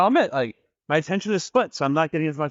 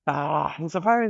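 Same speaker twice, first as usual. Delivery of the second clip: ordinary delivery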